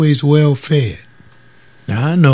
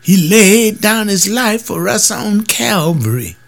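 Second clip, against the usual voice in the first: high, slow preacher voice; mock sermon line from the pulpit; imitating the preacher; 10 semitones higher than usual. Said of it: "the preacher will say".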